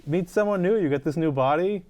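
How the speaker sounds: ordinary talk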